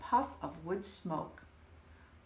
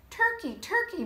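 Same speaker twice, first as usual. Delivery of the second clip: much higher, sweet coaxing call; 13 semitones higher, 5 dB louder than usual. Acted. Mister Moose sweetly calling Turkey from his nest. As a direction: coax him out like calling a pet. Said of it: "called in his sweet voice".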